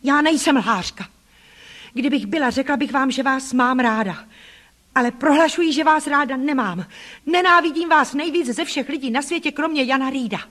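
put-on high, loud childish defiance; a girl finally snapping back at her aunt; defiant outburst. Child defies her cruel aunt.

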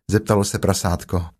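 plain reading voice

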